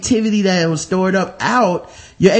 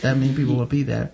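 ordinary talk, nothing marked

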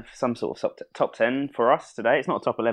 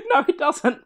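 higher, laughing through the words; host caught out, laughing; laughing admission; 14 semitones higher, 4 dB louder than usual. Real, not acted. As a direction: concede it while cracking up.

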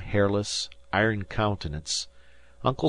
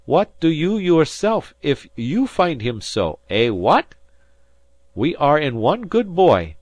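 much higher, louder, foreign-lilted sailor; a kindly Portuguese sailor pleading, much higher; Portuguese fisherman; 6 semitones higher, 6 dB louder than usual. A narrator's reading. Manuel's accented fisherman voice.

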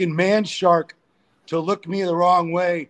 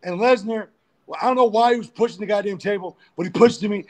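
normal talking voice

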